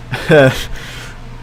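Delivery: short breathy laugh to oneself. Real, not acted. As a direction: let out a voiceless chuckle. Amused by own story.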